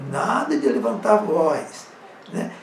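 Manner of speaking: usual speaking voice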